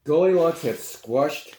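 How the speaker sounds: ordinary delivery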